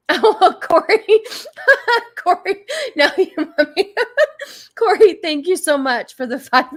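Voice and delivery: high, giggling delight; laughing. Real, not acted. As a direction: laugh through the name. Laughing thanks to donor.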